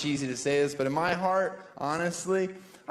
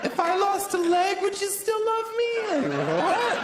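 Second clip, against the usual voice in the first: high whiny question; mock needy girlfriend. Comic imitating a needy girlfriend, high pleading.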